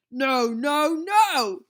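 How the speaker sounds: loud, big man yelling; shouting. A narrator's reading. Cigar-smoking boss rejecting a crying kid, loud shout. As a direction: bellow the rejection. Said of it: "he shouted".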